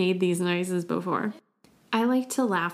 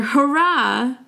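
a higher, louder hurrah; cheering hurrah; 5 semitones higher, 5 dB louder than usual. Shown as a demonstration. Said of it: "let's celebrate".

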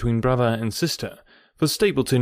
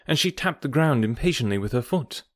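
ordinary delivery, nothing marked

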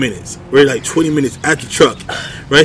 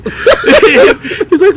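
ordinary talk, nothing marked

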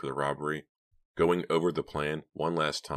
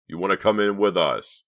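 loud, raised, impatient question; 5 semitones higher, 8 dB louder than usual. A narrator's reading. Leader questioning the wavering driver, impatient. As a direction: push for an answer forcefully. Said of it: "unable to hide his impatience".

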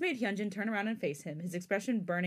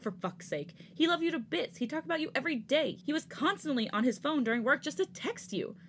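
higher and louder, angry reproach; raised voice; 7 semitones higher, 4 dB louder than usual. Acted. Hyunjin yelling at Jisung over Changbin, raised angry voice. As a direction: let it rise as he lashes out. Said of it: "Hyunjin suddenly raised his voice".

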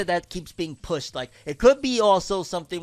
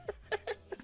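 breathy high giggle; 13 dB quieter than usual. Real, not acted. After teasing joke.

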